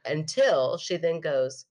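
ordinary delivery

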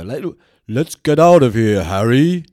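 loud bellow where a squeak belongs; wrong voice for Hermione. Shown as a demonstration. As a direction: boom it out, comically miscast as Hermione. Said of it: "then I would do wrong ones".

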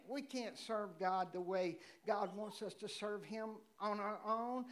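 normal talking voice

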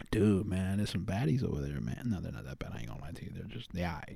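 hushed, dropping to near silence; whispering. Real, not acted. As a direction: say it under your breath. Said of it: "Why are you whispering?".

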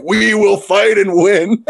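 loud, slow, high rallying cry; hammed-up heroic captain's pep talk; all-American team captain. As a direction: imitate a captain firing up the team. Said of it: "the stereotypical all-American captain".

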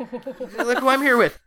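over-caffeinated and thrilled to show someone off; manic excitement. Acted. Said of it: "kind of manic".

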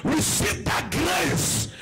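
high, loud declaration over the congregation; fervent preaching prayer. Real, not acted. Preacher blessing the congregation's work, fervent shouted prayer.

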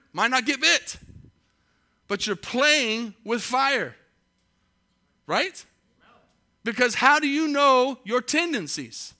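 high, loud fiery preaching; full-force preaching. Acted. Preacher warning the congregation about drink, loud and high. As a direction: build it like a revival sermon, loud and high.

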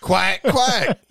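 high, squawky quack; duck voice. Pretend. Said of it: "can you do the Donald voice?".

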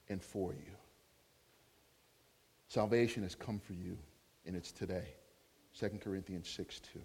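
low, soft, solemn ministering; hushed, low declaration of a word from God; prophetic blessing. Real, not acted. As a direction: speak gently over one person, pausing between phrases. Gives prophetic word to Kim.

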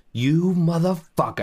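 high, loud mock cursing; angry curse. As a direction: snarl the insult at the stranger. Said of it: "Instead of".

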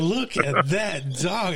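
slow, high, hammed-up mimicry; mocking imitation. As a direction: drag out her line in a high voice. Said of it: "I said".

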